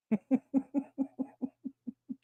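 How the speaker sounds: long high laughter. Real, not acted. Streamer cracking up at her own Vader joke. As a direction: laugh through it.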